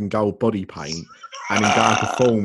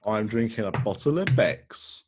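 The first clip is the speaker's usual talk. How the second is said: ordinary talk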